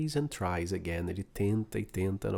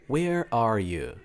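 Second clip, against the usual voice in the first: slow teaching model; 5 dB louder, much slower than usual. Shown as a demonstration. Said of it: "Então, comigo".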